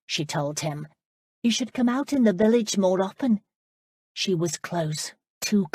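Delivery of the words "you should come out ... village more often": put-on higher, lighter voice for the girl Amanda; bright young girl pressing a question; young woman's voice. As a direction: play the eager young lass, pitched up. Amanda's voice, narrated scene.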